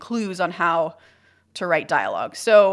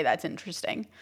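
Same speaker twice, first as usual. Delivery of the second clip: much softer, noncommittal reply; muted, polite non-agreement; unspoken disagreement; 3 semitones lower, 10 dB quieter than usual. Shown as a demonstration. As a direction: say it quietly, holding back disagreement. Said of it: "if I disagree with you".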